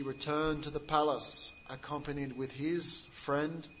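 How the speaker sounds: ordinary talk, nothing marked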